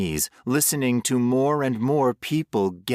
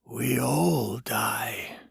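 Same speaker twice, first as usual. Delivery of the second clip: a hint of calm stranger, slow; 3 semitones higher, much slower than usual. A narrator's reading. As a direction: state it serenely. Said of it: "the stranger continued".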